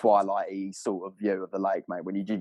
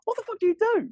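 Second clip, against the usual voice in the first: incredulous thought; 4 dB louder than usual. Retold or imitated. Imagined thought, shouted.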